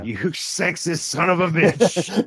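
high, drawn-out mock insult broken by laughter; mock outraged complainer. Host voicing a complaint to the show, mock outrage. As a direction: play an offended writer, laughing through it. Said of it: "people are writing the show to complain".

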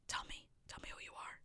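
hushed, mysterious whisper; very soft, voiceless plea; playful whisper. Pretend. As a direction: whisper it to a secret listener. Whispered appeal to the unknown listener.

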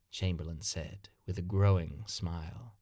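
plain reading voice

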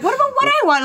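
very high, loud playful squeal; mock protest. Daughter to dad who only asks after the sisters, mock-indignant. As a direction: cry it out as a hammed-up complaint.

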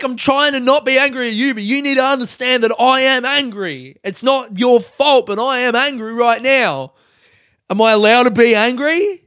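loud, exasperated self-justifying rant; angry loud voice. Retold or imitated. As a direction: re-enact an angry outburst at full voice. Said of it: "using my loud speaking voice".

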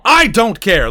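annoyed. Real, not acted. Ranting, repeated louder.

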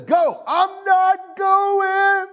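very high wail, stretched out slowly; whining refusal. Acted. Father refusing Jesus's order to go, long high whine. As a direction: draw it out into a hammed-up whine. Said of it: "stayed there and whined".

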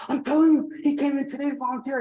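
very high, excited repeat; emphatic insistence. Retold or imitated. Nurse convincing disbelieving patient the man is here, emphatic insistence.

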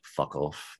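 low, soft attempt at a Boston accent. Englishman quoting how Bostonians talk, rough Boston accent attempt. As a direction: try a Boston accent, kept low and quiet. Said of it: "I love the Boston accent".

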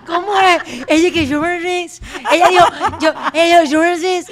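loud, high, through laughter; shouting it out in hilarity; laughing teasing. Real, not acted. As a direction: crack up while teasing a friend. Teasing over her pronunciation, laughing.

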